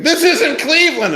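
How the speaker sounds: high, laughing disbelief; exasperated outburst. Retold or imitated. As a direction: blurt it out half laughing. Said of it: "beside himself saying".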